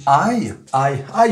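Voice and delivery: "I" said as a loud "aïe"; like a cry of pain. Shown as a demonstration. Teacher likening the English vowel to French ouch, exclaimed. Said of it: "j'ai mal".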